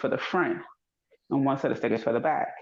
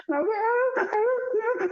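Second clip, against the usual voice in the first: mock crying; much slower than usual. Retelling crying at the traffic stop.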